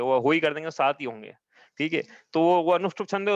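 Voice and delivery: ordinary talk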